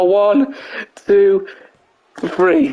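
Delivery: high, drawn-out referee-style count; pinfall count. Real, not acted. Commentator counting the pin to end the match, high and emphatic. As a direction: call the count high, one number at a time.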